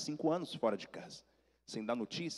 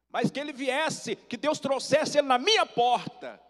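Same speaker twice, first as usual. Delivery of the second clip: high, slow, emphatic acted plea; fervent exclamation; 12 semitones higher, 6 dB louder than usual. Aunt telling nephew of her prayer, slow fervent climax.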